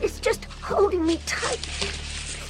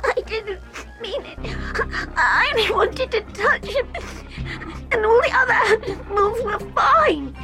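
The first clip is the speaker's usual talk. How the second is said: put-on much higher, crying child's voice; boy crying his apology to the doctor; tearful boy. A narrator's reading. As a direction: sob out the excuse. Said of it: "tears now streaming down his face".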